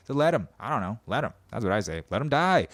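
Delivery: usual speaking voice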